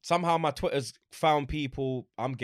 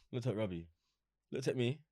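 usual speaking voice

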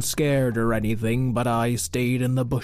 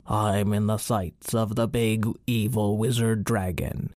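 ordinary delivery

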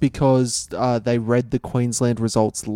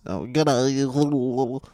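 John Howard voice; 4 dB quieter than usual. Retold or imitated. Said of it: "That's how he talks".

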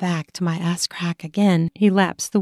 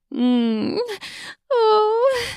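moan. Acted. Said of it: "I moan loudly".

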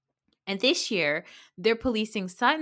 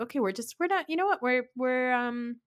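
higher, halting mock awkwardness; awkward deflection; 6 semitones higher, faster than usual. Everybody stares at each other, imitating awkward deflection. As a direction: stammer delicately around the subject in a high voice.

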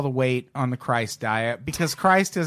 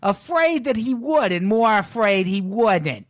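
lightly voiced Brooklyn guru; brooklyn accent; 6 semitones higher than usual. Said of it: "thick Brooklyn accent".

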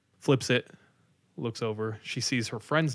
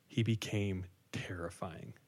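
hushed whisper; 3 semitones lower, 8 dB quieter, slower than usual. Real, not acted. Storyteller whispering the creepy moment to a friend. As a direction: drop to a whisper on the scary part. Scary turn in a retold story.

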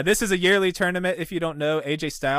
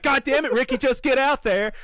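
raised, high-pitched mock order; hammed-up backstage exasperation; exasperated showrunner; 10 semitones higher, 4 dB louder than usual. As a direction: push the performer out, pitched high. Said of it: "And he's like".

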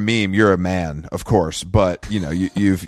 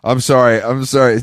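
ordinary delivery

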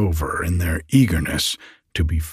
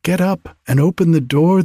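high, urging fairy call; storybook cry for help, pitched well up; 9 semitones higher, 3 dB louder than usual. A narrator's reading. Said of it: "they cried".

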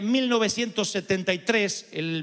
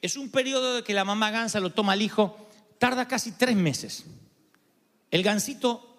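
ordinary delivery

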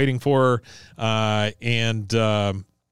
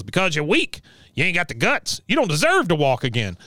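raised-pitch berating impression; Cotton; 5 semitones higher than usual. Recapper as Cotton berating a woman in rehab, high-pitched scorn. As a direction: berate her in a higher character voice. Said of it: "He goes".

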